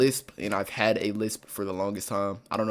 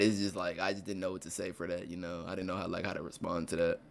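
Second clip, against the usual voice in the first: much softer, trailing off; quiet; 4 semitones lower, 11 dB quieter than usual. Real, not acted. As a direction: mumble it, embarrassed. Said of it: "You seem kinda quiet".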